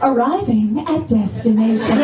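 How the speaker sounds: lightly imitated navigation voice, slowed; GPS voice in bed. Comic directs her man in bed like the GPS lady. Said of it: "the right tone of voice".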